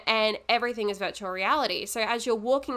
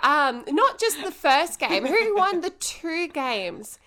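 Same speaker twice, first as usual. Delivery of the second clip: slow, teasing smug gloat; 5 semitones higher, 4 dB louder, slower than usual. Real, not acted. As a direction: drag out the gloat. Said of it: "She's so humble".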